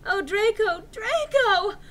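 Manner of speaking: shrill high overacted cry; overdone melodramatic scream of a name; hammy stage actor. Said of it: "I screamed".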